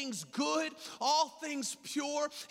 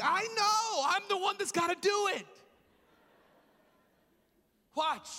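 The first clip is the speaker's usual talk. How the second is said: exasperated reply; 8 semitones higher than usual. Retold or imitated. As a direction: protest in a high, hurried voice. Retelling his reply to wife.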